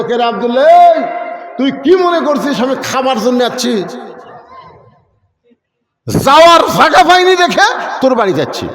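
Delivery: put-on hurt old father yelling at his son; shouting father. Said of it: "চিল্লায় বলতেছে".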